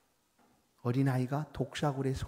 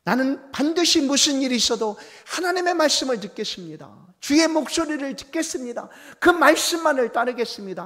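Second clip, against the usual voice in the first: fervent vow; 11 semitones higher, 13 dB louder than usual. Acted. Believer vowing to obey God's voice, loud and fervent. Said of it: "용기와 믿음이 삶에 필요하다".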